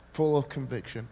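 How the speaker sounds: normal talking voice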